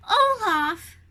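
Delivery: loud call across the snow. A narrator's reading. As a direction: shout his name to find him. Spotting Olaf's carrot in snowdrift.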